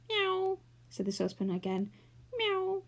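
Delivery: high mock meow; cat meow. A narrator's reading. Saucepan mewing at the clockwork mouse. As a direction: mew like a cat to scare it.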